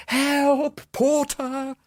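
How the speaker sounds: high, loud desperate cry for help; soul-piercing cry. Acted. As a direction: scream it from the top of the stairs. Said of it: "I heard a soul-piercing cry".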